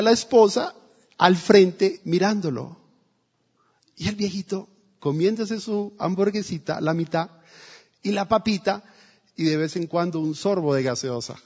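ordinary delivery

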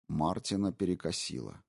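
plain reading voice

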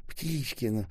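high, pleading babble; shuddering mumble. A narrator's reading. Киселёк trembling as док treats his head, babbling. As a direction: beg anxiously, climbing in pitch. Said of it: "бормотал Киселёк, вздрагивая".

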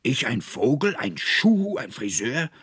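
high, loud outraged questioning; furious shout. A narrator's reading. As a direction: throw each name back in indignant disbelief. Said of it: "schrie der Konrektor voller Zorn". (zorniger, empörter Aufschrei)

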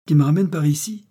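put-on a happy drunk tossing the question back; beaming and carefree; jolly tipsy. A narrator's reading. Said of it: "son air épanoui d'ivrogne béat et satisfait".

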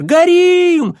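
panicked high yell; вопль. Acted. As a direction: scream it out in alarm. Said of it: "завопил". (испуганный вопль)

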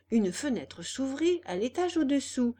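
plain reading voice

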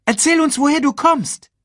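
high, loud urging call. Acted. Meerkat asking the cub his story, high loud call. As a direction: keep calling out, pitched high. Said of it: "rief er Simba zu".

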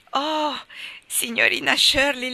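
in ecstasy. Acted. Carlotta watches the couple from the window, ecstatic. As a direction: gush it out in rapture. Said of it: "bisbigliò Carlotta quarta in estasi".

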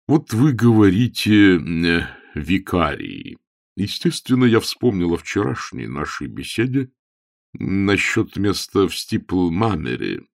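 lightly voiced bishop, hesitating; halting cleric with drawn-out pauses; uneasy bishop. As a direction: ease awkwardly into a sore subject.

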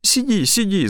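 high, anxious fussing; worried. Acted. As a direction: fuss over her in alarm. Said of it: "забеспокоилась она". (встревоженно)

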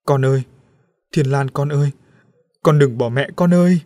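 put-on loud, anguished wail after a departing child; despairing mother's call; desperate cry. A narrator's reading. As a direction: cry out, begging her not to leave. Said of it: "Bà Ngọc Lan kêu lên tuyệt vọng".

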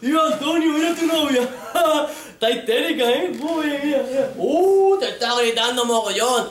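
high, loud, gawking bystander voice; gossiping onlookers. Retold or imitated. Onlookers tell Antonio his girlfriend is hysterical, gawking high voice. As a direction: nudge your mate and point at the scene. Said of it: "Y la gente".

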